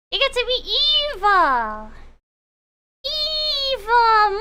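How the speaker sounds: hammy villain relishing evil; sneering panto villain. Pretend. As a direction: ham it up like a pantomime baddie. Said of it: "Being an N tag is fun".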